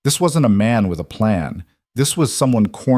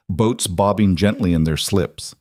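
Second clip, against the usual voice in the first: ordinary delivery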